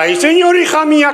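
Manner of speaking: a friend hearing the awful news, with hammed-up shock; mock shocked lament. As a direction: gasp it like a scandalised neighbour. Friend reacts to tragic news.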